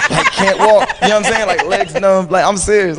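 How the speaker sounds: laughing. Real, not acted. Laughing while telling.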